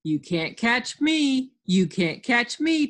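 high, slow playground taunt; teasing. Acted. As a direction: taunt them in a drawn-out singsong. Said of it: "teased Alf".

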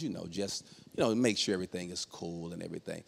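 low, soft, overly polite voice; police voice. Acted. Husband reporting lockout to police, low polite voice. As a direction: speak low and carefully proper for the officers. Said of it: "I put on my police voice".

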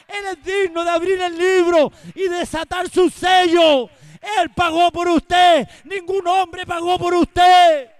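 loud, ringing pulpit cry; fiery Spanish sermon shout; full-force preaching. Acted. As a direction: preach it out to the whole congregation.

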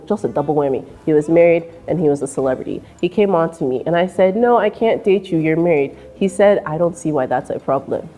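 ordinary delivery

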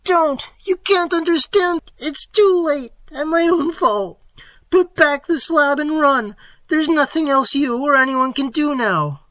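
very high, loud, despairing shriek; frantic high scream, utterly hopeless. A narrator's reading. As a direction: scream it as if all is lost. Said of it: "changed to a scream of utter despair".

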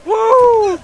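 loud, very high cheer; excited high cry; high whoop. Real, not acted. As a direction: whoop with delight. Cheering at a jump.

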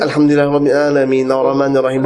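quick, run-together recitation; hurried prayer on one level pitch; trying to recite. Acted. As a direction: rattle through the prayer in one go. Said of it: "เราเนี่ยพยายามที่จะ".

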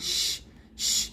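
"শ-" as a voiceless rushing hiss, twice; spreading sh sound. Shown as a demonstration. Teacher illustrates the spreading quality, airy hissed "শ-". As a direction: spread the air out on a bare "শ-". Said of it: "এরকম একটা শব্দ হয়".

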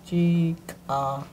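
Chikala said slowly and carefully; careful pronunciation. Shown as a demonstration. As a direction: Chikala drawn out with care. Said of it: "that doesn't sound like a regular".

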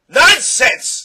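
huge high outburst; roaring. A narrator's reading. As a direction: roar it in outraged disagreement. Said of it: "the doctor roared".

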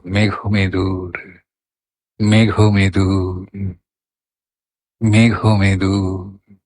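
slow মেঘমেদুর, lingering on the hummed m and final syllable; sweet, tender. Shown as a demonstration. Teacher showing the sweetness of the m sound, slow and tender. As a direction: stretch the word out sweetly and gently. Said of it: "ম দিয়ে মধুরকে প্রকাশ করে".

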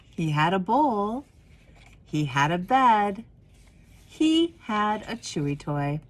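gentle storybook reading voice; warm, unhurried storyteller; reading to children. Acted. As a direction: read it like a picture book to kids at story time. Reads picture book aloud.

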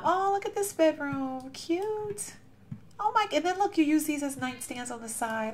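high, soft, slow cooing; cooing admiration. Real, not acted. Admiring the viewer's bedroom, soft high cooing. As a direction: coo over something adorable.

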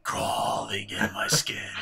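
slow husky whisper; whispered emo lyric. Retold or imitated. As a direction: murmur it like brooding emo lyrics. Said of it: "listen to [name]".

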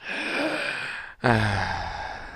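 slow deflated exhale; drawn-out defeated sigh; long defeated sigh. Real, not acted. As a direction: let the loss out in a sigh. After losing the fight.

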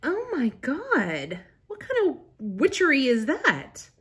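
high-pitched, awed disbelief; astonished. Real, not acted. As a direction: marvel as if it were magic. Astonished by a glass chiller.

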